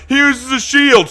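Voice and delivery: high, loud mimicry of a silly argument; mocking objector. Player mimicking those who defend the Spartan as knight, mocking.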